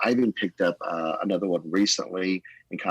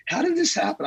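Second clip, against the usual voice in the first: much higher, amazed exclamation; astonished cry; 3 dB louder than usual. Retold or imitated. Pastor amazed at how the church has grown, astonished cry. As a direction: cry it out in wonder. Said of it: "And I'm like".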